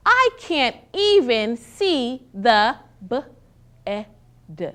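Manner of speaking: "b-, e-, d" as separate sounds, pause between; sounding out words. Shown as a demonstration. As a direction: stretch "b-, e-, d" sound by sound. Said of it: "I'm gonna sound out the answer".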